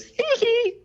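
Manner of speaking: very high-pitched squealed "hee hee"; tiny falsetto squeal imitating someone strangling himself; squeaky mock choking noise. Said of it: "choking his own throat".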